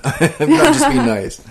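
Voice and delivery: laughing and protesting. Real, not acted. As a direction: laugh it out, high. Laughing denial.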